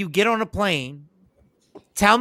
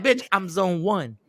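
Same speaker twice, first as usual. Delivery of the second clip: softer, slower "Bitch, I'm zone one"; slow quiet smug retort; 6 dB quieter, slower than usual. Retold or imitated. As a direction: deliver it slowly and smugly under the breath. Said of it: "just so I can be like".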